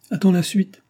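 high, tearful cry. A narrator's reading. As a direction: choke it out through tears. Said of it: "fait-elle en pleurant à chaudes larmes".

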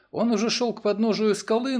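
usual speaking voice

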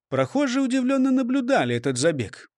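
usual speaking voice

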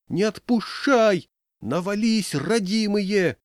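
high, drawn-out urging cries; frantic yelling. A narrator's reading. Crowd urging men hauling the bell, frantic. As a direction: urge them on desperately, high and stretched. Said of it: "истошно завопили в толпе".

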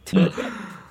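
bright rising laugh; a quick high burst of laughter. Real, not acted. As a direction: laugh out, caught off guard. Laughing after thanks.

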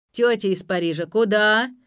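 loud, rising challenge; yelling. A narrator's reading. Bugay stopping the woman leaving, yelling. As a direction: holler it, pushing up on the last word. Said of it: "заорал Бугай".

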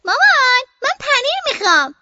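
put-on a child shouting to her mother for cheese. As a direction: yell from the room for cheese.

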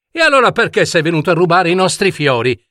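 rapid, high-pitched interrogation; indignant challenge. Acted. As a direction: fire the question like an outraged prince. Prince interrogates the monster. (domanda incalzante, acuta e veloce)

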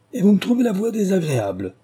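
high, scornful "trouvez" thrown back; mocking echo. A narrator's reading. Prince throws the insult back at the little man, outraged. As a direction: repeat "trouvez la voix désagréable" as an outraged sneer.

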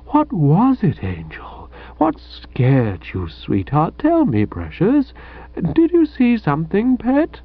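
high, doting coo to a frightened sweetheart; murmured endearments. A narrator's reading. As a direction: soothe her like a scared pet. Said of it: "the murmured endearments".

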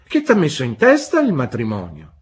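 loud, high-swooping incredulous question; astonished exclamation. A narrator's reading. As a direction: exclaim it, taken aback by the boy. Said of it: "esclamò Pigoti".

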